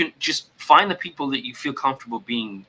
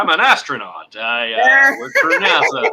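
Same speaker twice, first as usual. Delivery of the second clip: loud, higher mock boasting; first-date braggart; 9 semitones higher, 7 dB louder than usual. Host plays a dater pretending to be impressive, mock bragging. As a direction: brag loudly, hamming it up.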